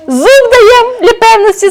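малий бандит. Acted.

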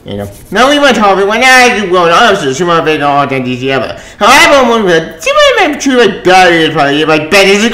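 ordinary talk, nothing marked

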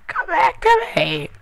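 loud, high-pitched frantic begging; wailing in a panic; panicked pleading. Real, not acted. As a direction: plead desperately after an accident. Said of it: "just had a heart attack".

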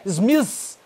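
"Smith" said as "smizz". Shown as a demonstration. English teacher showing a mistake, "Smith" as drawn-out "smizz". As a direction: end "Smith" on a long buzzing Z. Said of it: "não é Smith".

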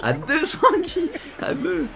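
mocking. Se moque en répétant l'adversaire.

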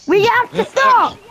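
high-pitched, pressing plea; urgent plea. Pretend. Player begging both fighters to halt, urgent. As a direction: urge them to break off the fight. Pretend rescue amid the fight.

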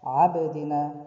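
abdina with the b bounced out; with qalqala. Shown as a demonstration. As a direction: bounce the b and come back. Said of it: "touch and come back".

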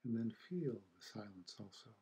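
unhurried meditation guide, long silences between phrases; guided meditation. Acted. Teacher guiding listeners to feel the silence after the chime, calm meditation voice. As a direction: ease listeners into stillness, leaving space after each phrase.

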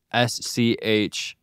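spelling slowly. Real, not acted. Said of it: "I don't know how to say that word".

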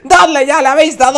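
insistent call. Real, not acted. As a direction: urge them loudly. Insistiendo a los espectadores.

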